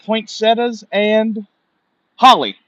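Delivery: high, loud, very slow drawn-out listing; excited naming. Real, not acted. Streamer excitedly naming holiday plants for chat. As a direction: savor each plant name, drawing it out loud.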